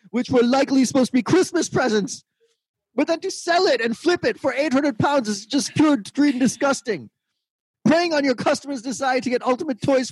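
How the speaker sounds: high, prim British voice reading a rant; mock-indignant refined Brit; outraged British commenter. Host reading an angry Facebook post in a high British voice. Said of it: "All-Star Monroe said".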